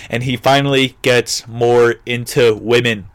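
ordinary talk

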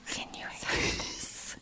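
breathy whisper, slow and close to the mic. Real, not acted. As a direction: lean in and whisper it slowly. Whispering to hear seltzer fizz.

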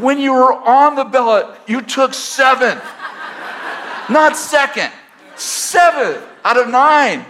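teasing a friend, laughing hard; laughing tease. Retold or imitated. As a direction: rib him through laughter, drawing out the numbers. Teasing friend about losing.

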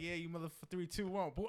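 high-pitched gamer taunting; mock trash talk. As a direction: imitate a hyped-up lobby countdown. Acting out gamer trash talk in lobby.

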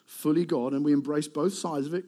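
ordinary talk

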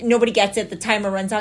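usual speaking voice

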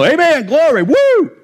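high mock church-crowd whoop; hammed-up high hallelujah cheer rising to a whoop; religious people cheering. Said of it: "and all the religious people".

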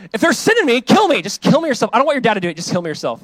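high, loud, overwrought plea; dramatic plea. Pretend. David begging Jonathan to kill him himself, high and loud. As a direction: throw yourself on your friend's mercy.

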